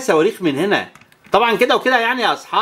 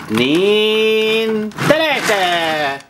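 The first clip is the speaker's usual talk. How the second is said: drawn-out countdown; 2 semitones higher, much slower than usual. Acted. Race countdown with toy planes.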